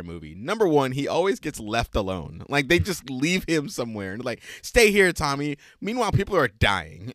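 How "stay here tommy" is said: calling out. Retold or imitated. Friends leaving Tommy behind.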